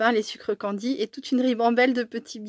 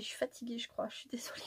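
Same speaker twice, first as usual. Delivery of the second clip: much softer, whispered tired apology; 13 dB quieter, faster than usual. Real, not acted. Apologising to viewers for being tired, whispered. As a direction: fade into a worn-out whisper. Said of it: "j'arrive pas à parler aujourd'hui".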